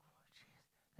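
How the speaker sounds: breathless whispered whoa; whispered amazement. Retold or imitated. Preacher recalling the paid-off debt, whispering in awe. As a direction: gasp it out in quiet disbelief. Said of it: "It's like".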